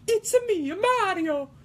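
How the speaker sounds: very high falsetto Italian plumber; sky-high cartoon mascot voice; Mario. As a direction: squeal the catchphrase with an Italian lilt. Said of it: "that Mario impression".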